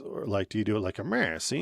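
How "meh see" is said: a hint of a 1930s gangster; tossed-off "meh, see" mobster; old-timey mob voice. Shown as a demonstration. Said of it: "old-timey mob voice".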